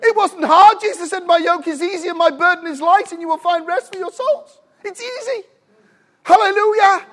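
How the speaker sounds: high, fired-up preacher; exultant, high-pitched preaching; full-force preaching. Real, not acted. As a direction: proclaim it excitedly to the congregation.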